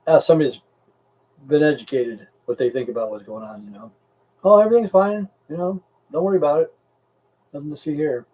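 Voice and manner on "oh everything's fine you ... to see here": lightly mimicked dismissive official, raised pitch at first; mock reassurance. Officials telling worried public all is well, mock reassurance. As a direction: brush it off in a mock everything's-fine voice.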